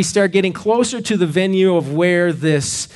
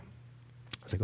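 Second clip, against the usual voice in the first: usual speaking voice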